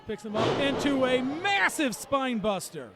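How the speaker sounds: loud, rising call of a big move; excited play-by-play. Real, not acted. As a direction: hype the spine buster.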